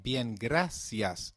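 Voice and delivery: bien, gracias said slowly with a clear s; slow Latin American bien, gracias; Latin American Spanish. Shown as a demonstration. Teacher contrasts Latin America, slow bien, gracias with s. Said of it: "in Latin America".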